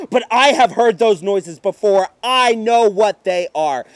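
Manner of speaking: ordinary delivery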